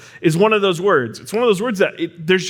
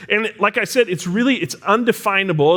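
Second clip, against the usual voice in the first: normal talking voice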